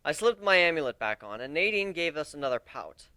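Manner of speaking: ordinary delivery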